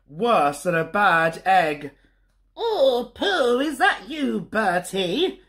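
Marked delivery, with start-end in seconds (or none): 2.5-5.4 lightly voiced granny, higher, with a chuckle; a hint of a tutting grandmother; sniffy Granny. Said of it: "sniffed Granny".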